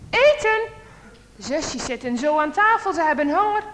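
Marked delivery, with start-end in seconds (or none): 0.1-0.6 mother calls the family to dinner, a mild call. Acted. Mother calling her children to the table, loud and bright. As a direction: call the kids in to eat. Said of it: "Moeder roept".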